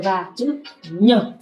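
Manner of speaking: very slow, nhờ drawn out; slow demonstration. Shown as a demonstration. Teacher slowly demonstrating the letter nhờ to a learner. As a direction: show the sound nhờ slowly. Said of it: "Ví dụ chữ".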